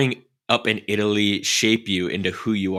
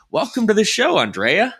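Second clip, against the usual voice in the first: bright lifted welcome, higher and a bit louder; warm welcome; 10 semitones higher, 3 dB louder than usual. Real, not acted. Co-host welcoming Andrea onto the show, upbeat. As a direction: greet the guest with a big smile. Greeting guest.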